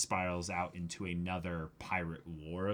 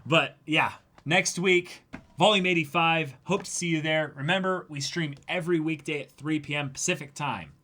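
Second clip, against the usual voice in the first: stream sign-off; 8 semitones higher, 12 dB louder than usual. Real, not acted.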